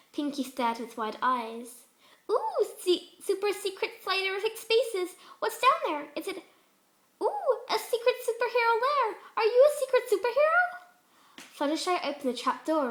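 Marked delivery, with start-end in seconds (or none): 2.3-10.6 much higher, louder, squealing pony; bursting with wonder; wildly excited Pinkie. Acted. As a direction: hyper pony gushing faster. Pinkie voiced in story.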